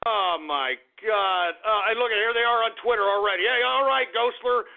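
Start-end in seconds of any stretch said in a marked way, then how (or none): none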